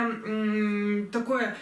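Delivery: ordinary delivery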